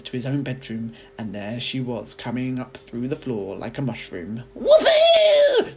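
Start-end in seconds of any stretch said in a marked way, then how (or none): 4.5-5.7 much higher, louder cackling crone whoop; a wild old witch crowing with glee; screechy old grandma shout. A narrator's reading. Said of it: "she shouted".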